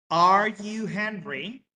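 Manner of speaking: slow, clear English for learners; careful classroom English, word by word; slow model sentence. Shown as a demonstration. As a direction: spell it out slowly for the class. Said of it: "teacher models question".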